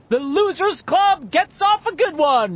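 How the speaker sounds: high, loud, drawn-out whoop; exuberant yell. A narrator's reading. Richie celebrating the escape with friends, yelling exuberantly. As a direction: crow it like a triumphant announcer. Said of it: "Richie yelled exuberantly".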